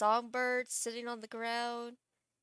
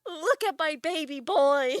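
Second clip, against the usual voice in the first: high, loud gleeful showing-off; excited doting pet owner; proud frog owner; 5 semitones higher, 7 dB louder than usual. Acted. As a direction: show off your darling, loud and high. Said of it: "He's just like".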